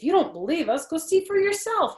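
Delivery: fast, high teasing dare; teasing boy voice. Acted. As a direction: dare her, quick and high. Jose teasing.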